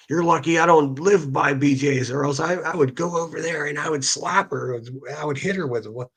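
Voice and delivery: mocking a rival streamer's threat to slap someone; mocking impression. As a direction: lampoon her threat in a silly voice. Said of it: "was just like".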